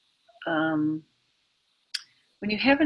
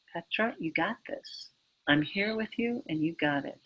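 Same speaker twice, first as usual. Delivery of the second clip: much softer, steady and reassuring; steady reassurance; 3 semitones lower, 11 dB quieter than usual. Pretend. To a triggered friend, soft steady reassurance. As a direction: hold them like a crying baby, calm and solid. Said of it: "standing there strong going".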